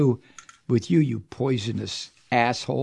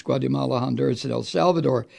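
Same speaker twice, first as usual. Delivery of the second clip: normal talking voice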